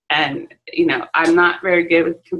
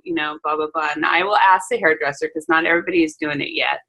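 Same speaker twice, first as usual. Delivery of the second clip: usual speaking voice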